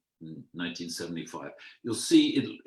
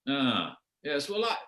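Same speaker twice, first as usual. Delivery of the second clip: lightly voiced hesitant Yorkshireman; mild Yorkshire-free imitation, hedging; Yorkshireman Morris; 7 semitones higher than usual. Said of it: "I can't do a Yorkshire accent".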